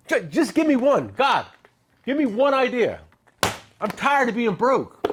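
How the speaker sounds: mock plea. Mock plea to God for ideas.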